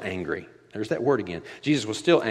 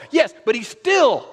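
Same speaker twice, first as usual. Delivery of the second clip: impassioned insistence; 8 dB louder than usual. Real, not acted. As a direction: lean into it with full fervour. Preacher's peak insistence.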